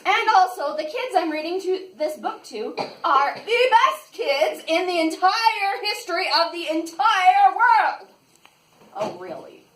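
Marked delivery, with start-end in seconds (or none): 0.1-8.1 loud grand proclamation. Pretend. Grand praise of the kids.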